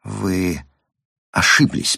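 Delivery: hesitant, halting murmur; faltering babble. A narrator's reading. Frightened psychiatrist babbles to the woman, mildly faltering. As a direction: falter slightly after the first word. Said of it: "пролепетал Кац".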